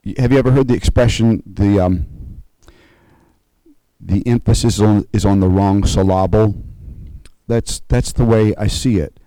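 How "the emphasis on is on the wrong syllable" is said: "syllable" said as suh-LAH-bul; wrong-syllable stress. Shown as a demonstration. Preacher quoting a joke expression to the congregation, "syllable" stressed as suh-LAH-bul. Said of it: "emphasis is on the wrong syllable".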